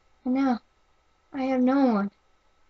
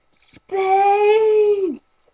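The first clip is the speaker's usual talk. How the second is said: much higher, louder, long drawn-out cry; joyful shout; 10 semitones higher, 5 dB louder than usual. Acted. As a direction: cheer it out together. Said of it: "together they cried".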